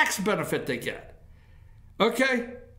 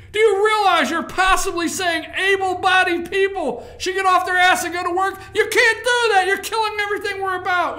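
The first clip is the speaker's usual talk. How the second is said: mock liberal outrage; 6 semitones higher, 4 dB louder than usual. Mocking outraged liberal reaction.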